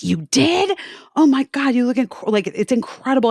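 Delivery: high-pitched retold astonishment; squeal-leaning, delighted disbelief; amazed reaction. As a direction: act out gushing over a friend's news. Retelling amazed reaction to friend's surgery.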